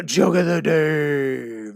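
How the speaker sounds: loud, drawn-out title call; slow, booming segment intro; segment announcer. Real, not acted. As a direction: stretch each word like a show announcer. Announcing his joke segment.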